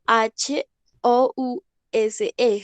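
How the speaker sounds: teacher spelling Milhouse slowly for students. Shown as a demonstration. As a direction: slowly spell out each letter. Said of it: "Milhouse se escribe".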